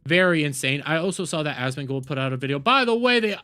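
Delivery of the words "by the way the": sudden higher, louder burst of excitement; excited. Real, not acted. Streamer excitedly announcing the donation total. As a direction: blurt out the big number, thrilled.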